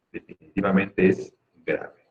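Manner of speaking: normal talking voice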